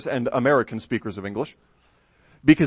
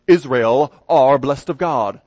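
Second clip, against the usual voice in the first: slow, louder British accent on "Israel are blessed of God"; 5 dB louder, slower than usual. Shown as a demonstration. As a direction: proclaim it like a British announcer. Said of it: "put it in a British accent".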